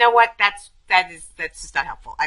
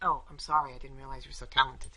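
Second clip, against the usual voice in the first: softer, dry mock apology; sarcastic; 5 semitones lower, 11 dB quieter than usual. Friend mocks the phone-checking listener, sarcastic. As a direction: drip sarcasm quietly. Sarcastic reply to multitask jibe.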